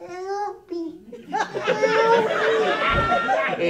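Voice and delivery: very high, tiny pleading squeal; tiny trapped fly. Acted. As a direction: squeak for help like a little fly. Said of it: "there's a tiny fly buzzing around".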